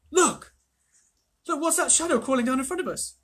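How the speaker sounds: high and loud excited pointing out; great excitement. A narrator's reading. Edmund points out the shadow to Lucy, in great excitement. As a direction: thrill at spotting something. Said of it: "he said in great excitement".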